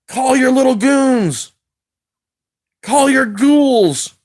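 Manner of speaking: slow, high, eerie mocking voice; drawn-out ghoulish taunt; spooky taunt.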